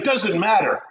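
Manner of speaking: high-pitched imitated fan excitement; imitating an excited friend's retort. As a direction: brush it off like a thrilled fan. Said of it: "very excited".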